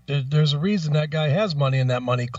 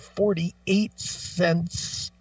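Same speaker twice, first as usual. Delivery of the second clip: very slow, drawn-out reading of the balance; mock slow tallying; much slower than usual. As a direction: spell out the sum painfully slowly. Mimics customer updating register.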